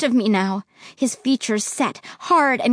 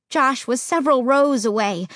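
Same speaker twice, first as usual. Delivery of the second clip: usual speaking voice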